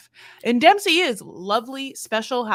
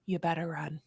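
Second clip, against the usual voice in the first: low quiet menacing warning; a soft threat from a protective father; threatening dad; 5 semitones lower, 11 dB quieter than usual. Pretend. Imagining being Dempsey's dad, quiet low threat. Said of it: "if I was Dempsey's dad, I'd be like".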